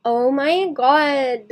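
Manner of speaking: put-on slow drawn-out valley girl oh my god. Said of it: "now I'm starting to lean into it".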